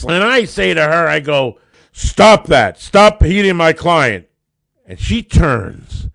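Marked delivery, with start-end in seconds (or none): 1.9-4.3 loud, firm command. Retold or imitated. Lawyer orders the woman to stop hitting his client, loud commanding voice. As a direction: bark the order to stop the attack. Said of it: "I try to take my best command voice".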